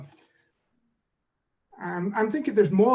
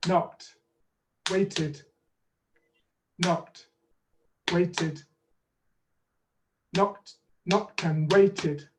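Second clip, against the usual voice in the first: slow, one beat per syllable; spaced out like claps; clapped syllables; much slower than usual. Shown as a demonstration. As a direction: tap out each syllable slowly. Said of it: "Maybe you could do it with little claps".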